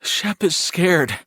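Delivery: high, slow, drawn-out words; scared, shaking. A narrator's reading. Shaking brother telling his brother, high and frightened. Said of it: "beginning to shake more violently".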